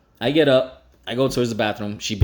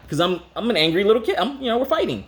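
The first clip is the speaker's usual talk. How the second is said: ordinary delivery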